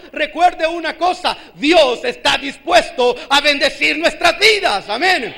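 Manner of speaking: loud, drawn-out proclamation; climactic preaching. Real, not acted. Preacher assuring the church of God's blessing, climactic preaching. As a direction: proclaim the promise at full force, slowly.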